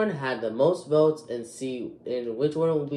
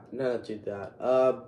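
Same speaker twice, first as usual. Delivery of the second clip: usual speaking voice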